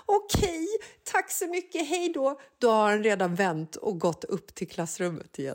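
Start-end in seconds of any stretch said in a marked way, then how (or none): 0.1-2.4 high, hurried polite goodbye; flustered thanks. Retold or imitated. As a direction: thank him like a stranger. Mother thanking son, flustered. (förvirrat tack, hög röst)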